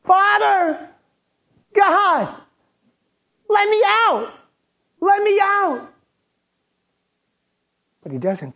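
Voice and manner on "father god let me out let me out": high, loud cry to be let out; desperate scream. Retold or imitated. A child locked in, screaming for his father. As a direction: scream for the father to open the door. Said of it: "And I begin to scream".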